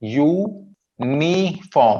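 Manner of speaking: Uniform slowly, split as यू, नि, form; three separate syllables. Shown as a demonstration. Teacher showing three syllables, uniform said apart. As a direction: pause between the syllables of uniform. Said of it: "three syllables हैं".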